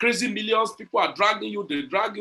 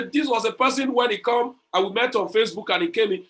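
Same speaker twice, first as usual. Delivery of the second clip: ordinary talk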